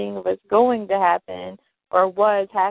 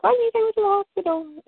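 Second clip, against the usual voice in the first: high, rushed mock indignation; retold complaint, pitched high and quick; imitating mad woman; 11 semitones higher, faster than usual. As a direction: put on an indignant woman's rapid protest. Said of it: "she gets mad".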